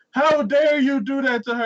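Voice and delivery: high, loud indignant cry; hammed-up indignation; mock outrage. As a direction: scold in theatrical horror. Outraged onlooker, acted.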